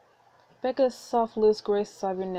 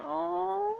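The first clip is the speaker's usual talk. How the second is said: touched aww; 11 semitones higher than usual. Real, not acted. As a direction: coo it, touched. Reader touched.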